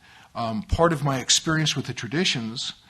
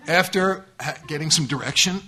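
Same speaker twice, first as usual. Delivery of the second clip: ordinary talk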